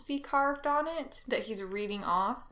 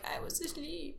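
sleepy bird; 5 semitones higher, 12 dB quieter than usual. Retold or imitated. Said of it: "Moltres pops out and is like".

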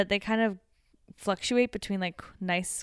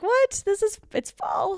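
high, incredulous mock reaction; retold disbelief; 4 dB louder, slower than usual. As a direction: act out being startled, voice shooting up. Retells surprise that fall arrived.

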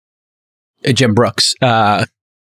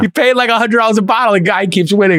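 excited; 8 semitones higher than usual. Real, not acted. Still excited about cheap wine.

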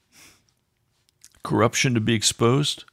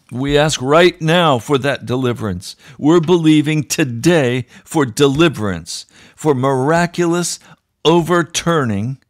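growing louder and higher into preaching; preaching with rising force; 4 semitones higher, 6 dB louder than usual. Real, not acted. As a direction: build the prayer to a fervent cry. Host's prayer building to preaching.

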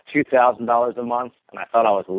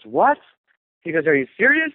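"serious" jumps much higher in disbelief; imitating a surprised mentor; 7 semitones higher than usual. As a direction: retell his stunned reaction, pitch climbing up.

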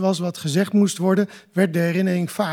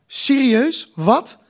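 stunned; 6 semitones higher, much slower than usual. A narrator's reading. Kai stands up in disbelief.

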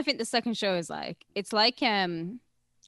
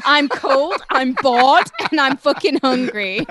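higher and much louder, a hammed-up whining rant; loud mock complaint; 6 semitones higher, 11 dB louder, slower than usual. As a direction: belt out the complaint as a playful mock tantrum.

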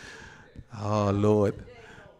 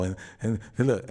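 ordinary talk

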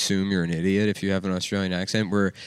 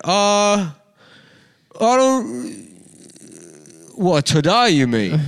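high, loud, halting British caricature; dim British guy; 10 semitones higher, 5 dB louder, much slower than usual. Retold or imitated. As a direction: play a slow-witted Brit, groping for words. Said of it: "was this British guy".

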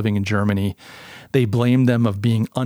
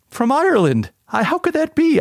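surprised; 14 semitones higher than usual. Real, not acted. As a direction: pitch shoots way up in disbelief. Amazed at Irish listener data.

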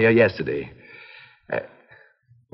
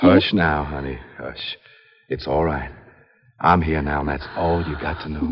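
soothing hush; 10 semitones lower, 3 dB quieter than usual. Acted. Brother soothing frightened sister.

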